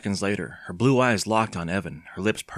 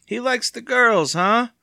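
high, loud, drawn-out teasing; chuckling glee; 13 semitones higher, 7 dB louder, slower than usual. A narrator's reading. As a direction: crow it slowly with amusement. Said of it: "still chuckling".

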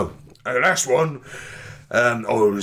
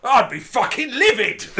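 high mock-outraged Cockney peak; livid; 5 dB louder than usual. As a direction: burst out in comic indignation. Acted rant peaks at livid.

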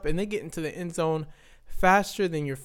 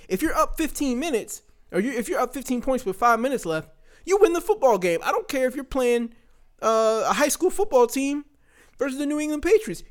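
exasperated rant; 8 semitones higher than usual. Real, not acted. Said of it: "So I'm hot".

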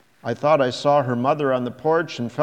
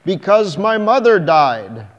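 loud, higher anguished shout; 6 semitones higher, 10 dB louder than usual. A narrator's reading. Man shouting at the child about his dead mother. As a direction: blurt it out in a grieving shout. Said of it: "I turned to her and shouted".

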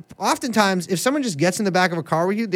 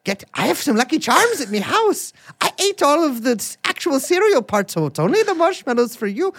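higher, hammed-up Irish brogue; a lightly done leprechaun voice; bad Irish accent; 7 semitones higher than usual. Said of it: "I'm not good at impressions".

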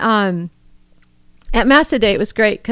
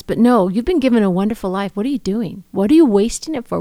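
ordinary delivery

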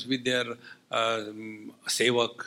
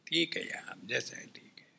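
soft whisper; 10 dB quieter, much faster than usual. Acted. Resigned acceptance.

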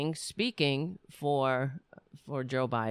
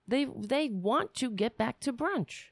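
higher, sing-song mocking tone; mock-prissy voice for the complacent Democrats; sarcastic mockery; 7 semitones higher than usual. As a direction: sneer at their wish for brunch.

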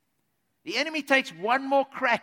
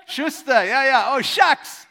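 loud, high mock shrug-off; mock dismissive woman; 4 semitones higher, 12 dB louder, slower than usual. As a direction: brush him off with a loud scoff. Mock woman brushing him off.

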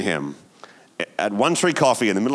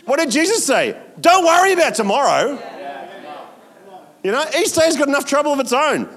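higher, louder exhortation; preaching climax; 5 dB louder than usual. Acted. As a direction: drive it home to the congregation. Sermon climax.

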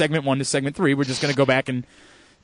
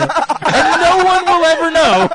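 laughing relief; 10 semitones higher, 4 dB louder, slower than usual. Real, not acted.

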